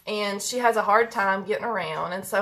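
ordinary delivery

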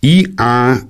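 high, loud proclamation; solemn, ringing address. A narrator's reading. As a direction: call out the name ceremoniously. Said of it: "sagte er feierlich".

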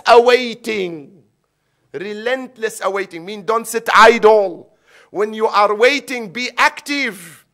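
loud, high-pitched preaching at full force; impassioned exhortation. Real, not acted. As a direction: rouse the congregation to act.

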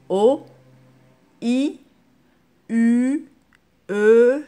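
each vowel called out loud and clearly, one by one; loud vowel demo. Shown as a demonstration. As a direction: project every vowel to the class like a teacher. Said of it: "vous avez A".

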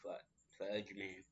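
hiccuping mid-word; words broken by a hiccup. Real, not acted. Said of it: "Also, I got the hiccups".

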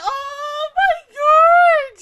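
drawn-out high loud oh my God; slow, tearful, loud outcry; overwhelmed wail. Real, not acted. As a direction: stretch it out, overcome. Overwhelmed by flashback.

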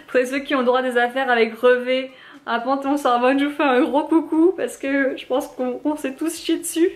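laughing through the words, pitch climbing; giggly commiseration with fellow sufferers; laughing shout-out. Real, not acted. Laughing shout-out to fellow exam takers.

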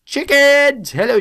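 high delighted shout of chickens; thrilled discovery call; gleeful chicken spotting; excited. Real, not acted.

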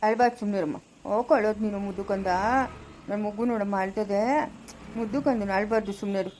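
soft, low hush for a weeping little one; quiet lullaby-like comfort; soothing a crying child. Acted. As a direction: coo gently to calm the baby.

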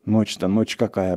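ordinary delivery

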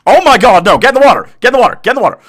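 very high, loud panicked shouts; desperate high shouting at a burning dog; frantic yelling. Real, not acted. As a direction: scream it to save the dog.

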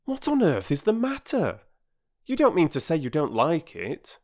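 put-on high, mildly impatient boy; boy impatient with a crying woman; a little impatient. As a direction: press her for an answer, slightly annoyed. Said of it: "perhaps a little impatiently".

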